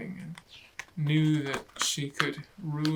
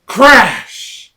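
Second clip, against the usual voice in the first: loud crash; 6 semitones higher, 20 dB louder than usual. Acted. Sound effect.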